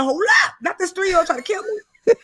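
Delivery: exaggerated indignant protest; mock alarm. Retold alarm at child attacking her.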